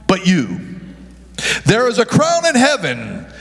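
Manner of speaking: usual speaking voice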